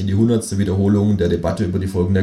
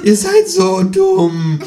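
very high, drawn-out exasperated croak; slow, squawking kangaroo scolding; 7 dB louder, much slower than usual. A narrator's reading. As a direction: stretch out the insult, high and loud. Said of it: "krächzt das Känguru".

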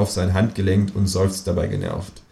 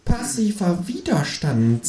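slow, high and measured; deliberate; 10 semitones higher, slower than usual. A narrator's reading. Känguru muses on passive resistance, slow and deliberate. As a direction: weigh each word slowly. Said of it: "sagt das Känguru bedächtig".

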